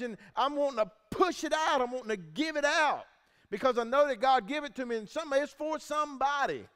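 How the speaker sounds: high, loud, driven sermon voice; urgent preaching. Real, not acted. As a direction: preach it like you have to get it out.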